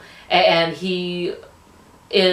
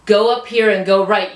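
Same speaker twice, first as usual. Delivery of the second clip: louder, raised voice for mock directions; calling out directions; 4 semitones higher, 4 dB louder than usual. Woman showing Jimmy how his directions sound, called out. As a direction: call it out like directions to a stranger.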